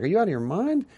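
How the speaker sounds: high, disbelieving are you out of your mind; hearing the dog will be put down, shocked; incredulous. Retold or imitated.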